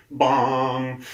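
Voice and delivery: loud mock bong; imitated gong. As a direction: ring out like a gong.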